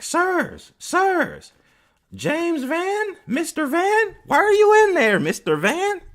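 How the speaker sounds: very high, loud mock woman's voice; residential advisor calling. Advisor walking towards the room, calling out in mock falsetto. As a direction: call out in a piercing put-on falsetto. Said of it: "I can hear her walking towards the room".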